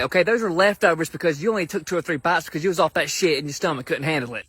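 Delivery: ordinary delivery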